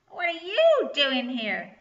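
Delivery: snapped. A narrator's reading. Goblin to the girls in the shrubbery, snapped. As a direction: snap it slowly and loudly as a cross goblin. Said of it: "he snapped".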